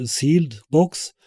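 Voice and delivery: ordinary talk, nothing marked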